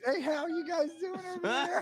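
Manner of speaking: high, loud call across to others; loud, high-pitched mock hail. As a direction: holler a greeting over to them. Calling over to others, acted.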